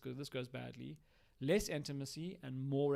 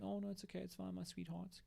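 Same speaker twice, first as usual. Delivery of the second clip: soft and higher, soothing; nurturing parent; 4 semitones higher, 9 dB quieter than usual. Shown as a demonstration. Talking to a spouse like a baby, soft, high and soothing. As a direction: coo it tenderly like comforting a child. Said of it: "You can hear a nurturing parent".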